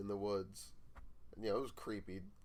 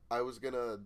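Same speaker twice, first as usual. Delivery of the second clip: plain reading voice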